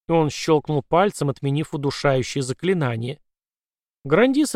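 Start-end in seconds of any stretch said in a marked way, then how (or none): none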